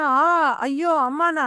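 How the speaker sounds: loud cry of pain then moaning.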